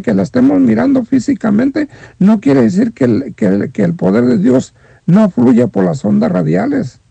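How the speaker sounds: ordinary talk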